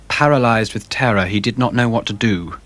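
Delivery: ordinary delivery, nothing marked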